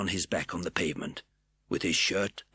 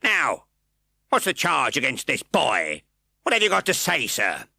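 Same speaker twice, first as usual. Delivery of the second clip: gruff magistrate; 6 semitones higher, 6 dB louder than usual. A narrator's reading. Fang interrogates.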